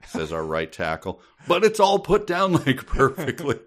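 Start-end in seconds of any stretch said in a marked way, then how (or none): 1.5-3.6 high, laughing disbelief; giggling exasperation. Real, not acted. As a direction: crack up mid-sentence, voice up high. Mocking book, laughing high.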